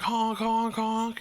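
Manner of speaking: high, held honks on one note; imitated car honking. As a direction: honk like a car horn. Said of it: "we hear, like, some honking".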